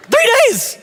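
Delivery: very high shout of surprise; exclaimed surprise. Real, not acted. Pastor exclaiming the three-day finding, very high. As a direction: shoot up on three in disbelief. Exclaims short happiness duration.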